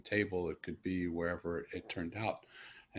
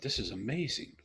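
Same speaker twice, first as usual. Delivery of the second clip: amazed; 6 semitones higher than usual. Retold or imitated. Retelling his thought of wonder.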